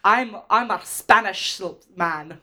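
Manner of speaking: slow, loud Scottish actor impression; Sean Connery. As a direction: draw it out as a mock Scottish film star. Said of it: "when Sean Connery was in Highlander".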